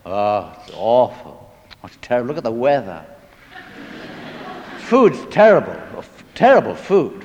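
a mildly hammed-up grumbling soldier; whining grumbler. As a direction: moan about everything like a sulky backliner. Complaining backline soldier answering visitors.

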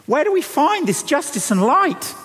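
pitched well up, pressing the question home; high ringing question. Acted. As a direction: lift the whole line high and urge it. Preacher presses the question again.

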